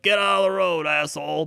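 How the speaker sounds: mock-bark at a driver; half-hearted New York driver yell; rude New Yorker. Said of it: "in New York, they're just like".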